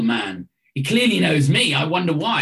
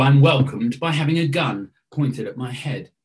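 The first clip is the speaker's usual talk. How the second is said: normal talking voice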